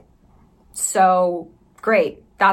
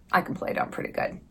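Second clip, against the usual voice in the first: usual speaking voice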